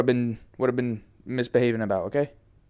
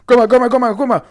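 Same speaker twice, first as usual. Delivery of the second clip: higher, louder repeated calls; calling out; 8 semitones higher, 8 dB louder, faster than usual. Real, not acted. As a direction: shout her name over and over. Calling out to grandma.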